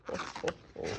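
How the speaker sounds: whispered ho ho ho; Santa laugh. Acted. Mother reading to her child, whispered Santa laugh. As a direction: breathe out a secret Santa laugh.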